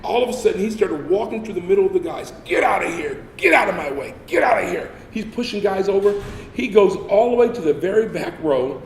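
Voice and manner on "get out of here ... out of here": loud barked orders, higher; drill sergeant yelling. Retold or imitated. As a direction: bark it like a drill instructor clearing the room. Drill instructor shoving through recruits.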